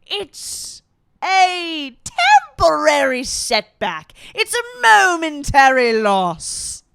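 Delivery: high, drawn-out theatrical villain; Preminger. Acted. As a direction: declaim it high and loud, stretching the words.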